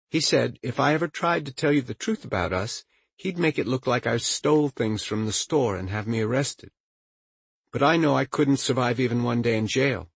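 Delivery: sweet, sociable phone greeting; pleasantly social voice. Acted. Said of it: "in a pleasantly social voice".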